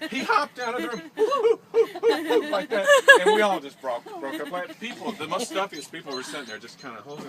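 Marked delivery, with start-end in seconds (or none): 1.2-2.9 high hooting while laughing; redoing the high hoot while cracking up; high-pitched whoo, laughing. Retold or imitated. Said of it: "this high-pitched noise".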